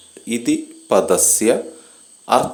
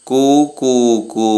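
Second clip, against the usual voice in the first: higher, slow sung-out mock cuckoo coo; cuckoo call; 4 semitones higher, slower than usual. Shown as a demonstration. Who calls coo coo coo.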